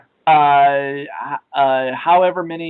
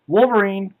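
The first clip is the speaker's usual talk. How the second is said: usual speaking voice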